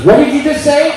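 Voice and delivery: high, loud indignant wife; wife catching an insult, hammed-up outrage; mock angry wife. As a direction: snap back like an offended wife.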